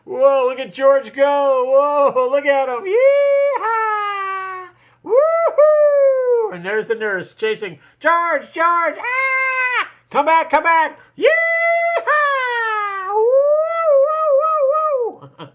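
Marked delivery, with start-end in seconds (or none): 0.0-2.8 loud, high, drawn-out amazement; excited cheering. Acted. Cheering on the runaway go-kart. 2.9-6.5 very high, loud cowboy holler; joyride whoop. Pretend. George's joyride voiced for children. 8.0-11.0 high, loud hammed-up shriek; panicked nurse calling. Nurse chasing George, voiced. 11.1-15.5 very high, loud cowboy holler; joyride whoop. Pretend. George's joyride voiced for children.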